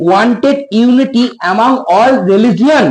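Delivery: slow, word by word; slow dictation. Shown as a demonstration. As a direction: read it out slowly for the class. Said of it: "উচ্চারণ দেখবো".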